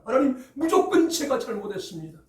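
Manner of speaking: high, loud, with 무조건 stressed and falling; loud confession. Acted. David loudly confessing his fault to God. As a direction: cry out the confession with full force. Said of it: "라고 고백하는 내용이".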